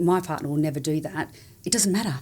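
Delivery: ordinary delivery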